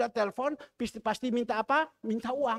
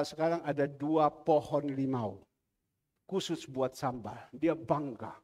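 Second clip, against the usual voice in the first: normal talking voice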